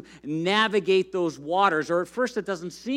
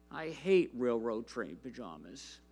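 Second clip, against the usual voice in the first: sulky; 7 semitones lower, 11 dB quieter than usual. Acted. Alexander's complaint read from the book.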